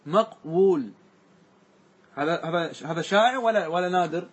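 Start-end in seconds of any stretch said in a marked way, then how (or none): none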